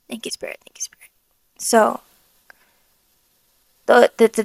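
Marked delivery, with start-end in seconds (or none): 0.1-1.1 soft unvoiced whisper of gratitude; whispered thanks. Real, not acted. Whispering thanks to the Spirit, overcome. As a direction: breathe the thanks out almost silently. Real prayer of thanks, breaking off.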